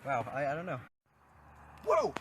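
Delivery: normal talking voice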